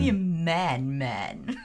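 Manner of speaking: lightly voiced swinging spy, fading to a murmur; a hint of a groovy British spy; Austin Powers. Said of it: "There's something very Austin Powers about that line".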